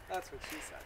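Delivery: breathy whispered punchline; whispered joke. Real, not acted. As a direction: slip the joke out under the breath. Joke muttered.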